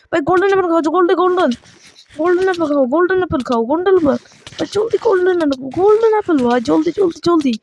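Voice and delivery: high, urgent repeated pleading; frantic urgency. Real, not acted. Gamer urging himself to eat the golden apple, high frantic urgency. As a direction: rush it out high as if life depends on it.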